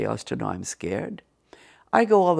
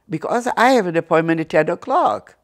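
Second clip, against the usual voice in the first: louder, higher firm insistence; emphatic, raised-voice pushback; acted insistence; 5 semitones higher, 7 dB louder than usual. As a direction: press the point loudly. Pushing back at secretary's refusal.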